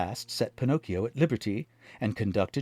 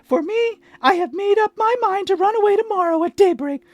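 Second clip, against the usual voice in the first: very high, loud puppet; 10 dB louder than usual. A narrator's reading. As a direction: pipe up like a wooden boy. Pinocchio voice.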